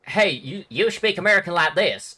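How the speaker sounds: first of several American accent parodies; mock American accent. Shown as a demonstration. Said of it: "most of it's gonna be like".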